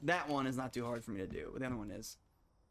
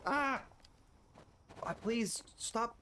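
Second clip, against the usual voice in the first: startled cry; 9 semitones higher, much slower than usual. Real, not acted. Controller acting up in game.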